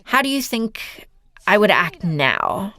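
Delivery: ordinary talk, nothing marked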